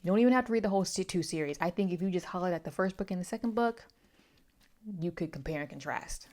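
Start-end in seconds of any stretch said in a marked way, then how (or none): none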